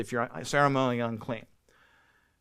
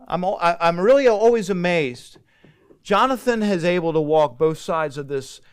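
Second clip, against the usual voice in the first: usual speaking voice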